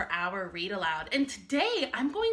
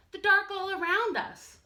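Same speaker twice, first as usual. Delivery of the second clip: higher small cub voice; a tiny scared cub, pitched up; little bear cub; 10 semitones higher, 3 dB louder than usual. Acted. Little Bear tells Big Bear he is scared, high small voice.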